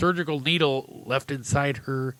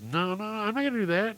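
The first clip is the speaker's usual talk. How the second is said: put-on high-pitched kid protest; refusing little boy; 10 semitones higher than usual. Boy refuses the clown's order, high and protesting. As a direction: object like an alarmed child.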